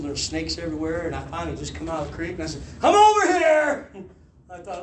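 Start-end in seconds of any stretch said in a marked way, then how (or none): 2.8-3.9 high, loud, drawn-out holler; calling out. Retold or imitated. Tired fugitive calling police from the creek, loud holler. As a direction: holler slowly to be found. Retold surrender call from creek.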